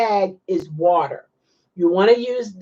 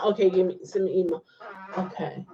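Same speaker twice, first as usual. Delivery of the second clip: ordinary delivery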